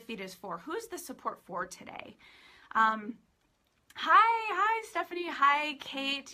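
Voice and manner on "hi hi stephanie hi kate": high, loud hellos to arriving friends; excited greeting. Real, not acted. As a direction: greet them brightly, starting high and easing down. Greeting experts as they join live.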